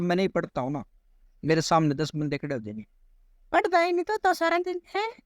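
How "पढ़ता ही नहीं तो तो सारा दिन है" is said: high mocking taunt from onlookers; mocking scolders. Imitating bystanders taunting the boy, high mocking voice. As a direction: ham up the nagging bystanders, pitched way up. People scolding a non-reader.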